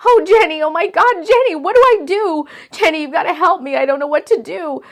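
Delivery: loud, high and panicked, pleading; frantic, near sobbing. Acted. Jade on the phone to her best friend, frantic and pleading. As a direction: beg for help, falling apart. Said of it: "She was sobbing and inconsolable".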